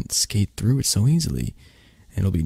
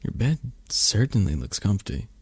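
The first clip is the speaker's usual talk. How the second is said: ordinary talk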